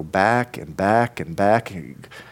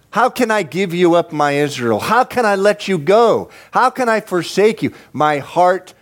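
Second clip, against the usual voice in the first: high-pitched impassioned plea; God pleading; 8 semitones higher than usual. Acted. God cries out to my Israel, pleading. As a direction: cry it out to a beloved people. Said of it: "this is what God says to Israel".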